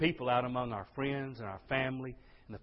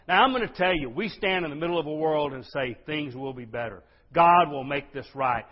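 full-force preaching; 3 semitones higher, 8 dB louder than usual. Acted. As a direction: preach it with everything you've got. Preacher's closing appeal.

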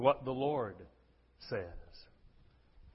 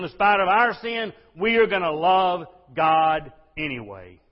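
full-force preaching; 5 semitones higher, 10 dB louder than usual. Acted. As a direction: preach it with everything you've got. Preacher's climax.

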